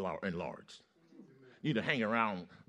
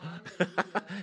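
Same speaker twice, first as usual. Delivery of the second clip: high-pitched mock laugh; imitated defiant laughter; 8 semitones higher than usual. Man laughing at Mister Devil, imitated defiant laughter. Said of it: "So I'm laughing at you".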